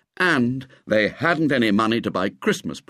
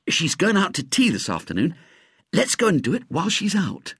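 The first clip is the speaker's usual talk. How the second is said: put-on higher, scheming boy; lightly voiced boy; 9 semitones higher than usual. A narrator's reading. Ginger plotting with William, higher boy voice. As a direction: plot the secret job.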